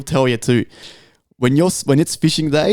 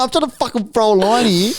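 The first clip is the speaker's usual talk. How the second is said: exasperated complaint; 8 semitones higher than usual. Retold or imitated. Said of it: "I'm like".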